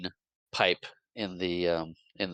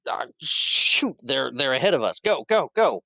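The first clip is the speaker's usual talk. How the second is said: urgent command; 5 semitones higher, 4 dB louder than usual. Retold or imitated. Graham urging chase.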